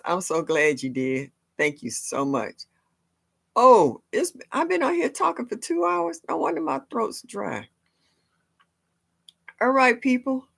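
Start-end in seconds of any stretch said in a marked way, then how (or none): 3.6-10.5 raised pitch. Real, not acted. Dry throat after two hours.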